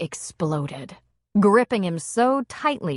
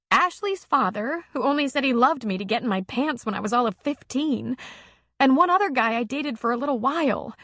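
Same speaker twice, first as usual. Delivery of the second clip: higher woman's voice, confiding; a lightly voiced woman opening up, higher; her voice; 6 semitones higher than usual. A narrator's reading. Female character voice.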